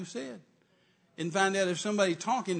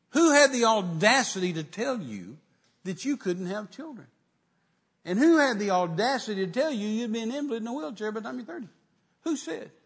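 raised-pitch indignant interrogation; retold anger; 6 semitones higher, 6 dB louder than usual. Man challenging what his fiancée was told, retold indignation. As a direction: re-enact outrage, attacking each question from the top. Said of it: "It just made me so angry".

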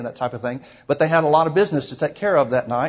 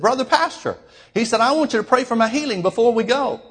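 high, strained holler across a long hall; 9 semitones higher than usual. Retold or imitated. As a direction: call out to the pastor from the back row. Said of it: "hollered out as loud as he could".

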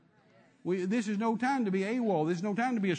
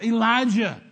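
loud, drawn-out mock dread; hammed-up scared king; 3 semitones higher, 8 dB louder, slower than usual. King Ahab met by the prophet he fears, hammed-up fearful cry. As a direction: cry out like a cornered king, theatrically. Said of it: "This is the king scared of the prophet".

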